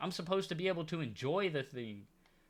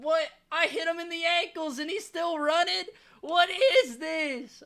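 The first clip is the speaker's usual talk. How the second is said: exasperated disbelief; 10 semitones higher, 7 dB louder than usual. Real, not acted. Blowout loss, opponents keep scoring.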